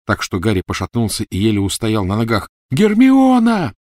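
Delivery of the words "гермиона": very high, loud, drawn-out scream; yell. Acted. Ron yelling for Hermione from the cellar. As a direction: bellow her name in anguish.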